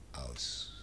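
dying whisper. Acted. Dying baron whispers to his kin, barely voiced. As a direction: breathe the word out, barely voiced. Dying man's last breath.

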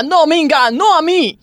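a touch of panic; mild panicked protest. Acted. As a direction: beg her to stop, half laughing. Coach being twisted by gymnast.

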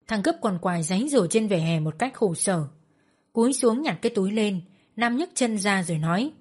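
ordinary delivery, nothing marked

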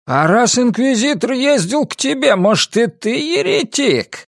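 high, accusing villager; voice from crowd. A narrator's reading. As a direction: throw suspicion from the crowd. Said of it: "послышалось от кого-то".

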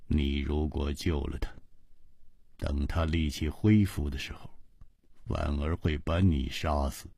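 low, soft, cold reply; Ji Bingyan's voice. A narrator's reading. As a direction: brush it off, unmoved. Ji Bingyan warns.

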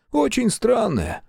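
put-on high, slow voice with an Irish accent. A narrator's reading. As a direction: draw it out in an Irish lilt. Irish sergeant agrees. (ирландский акцент, медленно)

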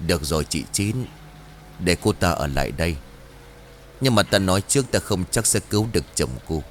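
low, measured voice of a wise master; calm old master. Acted. Master Lương calmly granting Trang permission to stay. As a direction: speak low and deliberate like a sage.

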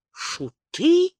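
high-pitched indignant question. A narrator's reading. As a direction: echo it back, affronted, pitch jumping up. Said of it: "возмутилась Зарит".

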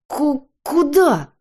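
loud, high, stuttered shout; stammered bellow of alarm; roared. A narrator's reading. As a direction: burst out in outrage, stretching the word. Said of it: "рявкнул он".

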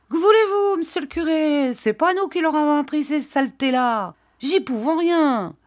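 high-pitched rustic villager voice; mimicked peasant parents. Parents answering the priest, mimicked peasant protest.

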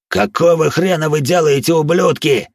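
furious roar. A narrator's reading. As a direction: bellow it with rage. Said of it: "взревел Харви".

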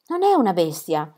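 usual speaking voice

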